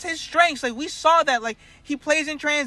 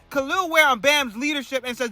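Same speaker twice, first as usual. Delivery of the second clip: usual speaking voice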